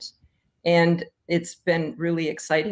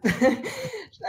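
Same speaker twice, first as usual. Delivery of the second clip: teary laugh; 13 semitones higher than usual. Real, not acted. Joking about the dance floor.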